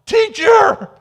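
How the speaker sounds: high, loud, slow cry of recognition; cried out. A narrator's reading. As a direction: cry the name, overwhelmed. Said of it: "and fell at his feet".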